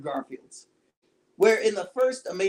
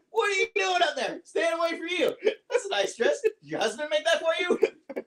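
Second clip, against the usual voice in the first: replaying the quip; 9 semitones higher than usual. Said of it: "That was actually a great quip".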